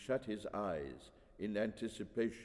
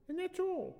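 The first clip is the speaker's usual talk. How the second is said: put-on very high little-girl voice; young daughter. Daughter finishing for mama, high childish voice.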